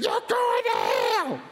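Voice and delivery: high, drawn-out scream; mock hellfire screech; imitated preacher's scream. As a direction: scream it long like a frenzied preacher. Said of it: "And so when he screamed".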